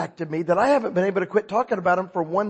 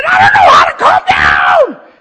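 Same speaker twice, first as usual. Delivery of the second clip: high, loud, desperate yell at the cop; frantic shouting; 14 dB louder than usual. Retold or imitated. New convert shouting at the cop. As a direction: shout it like you can't stop. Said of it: "I said".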